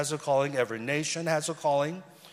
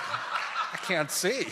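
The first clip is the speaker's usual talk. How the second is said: slow, higher-pitched joking cry, laughing; hammed-up dazzled reaction with a laugh; mock dazzled; 4 semitones higher, much slower than usual. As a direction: draw it out as if comically blinded. Joking quote of dazzled onlooker.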